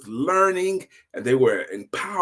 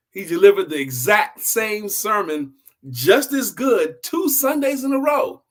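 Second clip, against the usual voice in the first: building emphasis; 7 semitones higher, 5 dB louder than usual. A narrator's reading.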